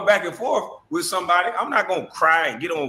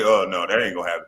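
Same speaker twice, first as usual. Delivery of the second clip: mock refusal; 10 semitones lower, faster than usual.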